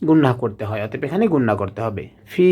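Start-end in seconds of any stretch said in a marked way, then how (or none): 2.3-2.5 "ফি" with a long-held vowel; one-alif stretch. Shown as a demonstration. Teacher showing an alif's length, "ফি" held long. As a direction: draw out the i of "ফি". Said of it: "ফি এক আলিফ টেনে পড়তে হবে".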